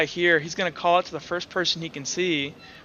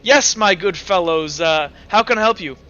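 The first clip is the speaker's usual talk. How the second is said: jovial merchant; 2 semitones higher, 9 dB louder than usual. Acted. Merchant greeting the guards, hearty and loud. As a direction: play the charming host. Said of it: "wobbles down and goes".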